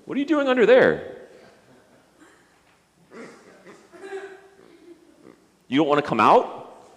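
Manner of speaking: high, loud coaxing call to a hiding puppet. Pretend. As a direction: coax him out from under the chair, high and bright. Coaxing a puppet under chair.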